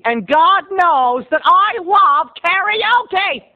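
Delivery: high, drawn-out declaration of love; gushing enthusiasm. Real, not acted. Host confesses love of karaoke, gushing. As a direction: stretch it out, high and rapturous.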